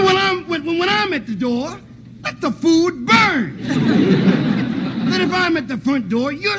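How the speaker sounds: high, slow, stretched scolding; high scolding uncle. Retold or imitated. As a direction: drag it out high and preachy.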